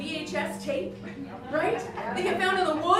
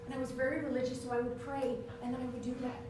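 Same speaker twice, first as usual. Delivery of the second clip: hushed confession; 6 semitones lower, 9 dB quieter, faster than usual. Acted. Pray-then-do-it alternation.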